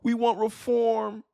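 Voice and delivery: high, drawn-out mocking voice; virtue-signaling leftist. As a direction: mimic a preening leftist in a high voice. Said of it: "when these leftists come here trying to virtue signal".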